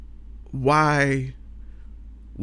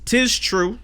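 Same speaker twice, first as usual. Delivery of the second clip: mock archaic; 11 semitones higher than usual. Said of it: "mock archaic agreement".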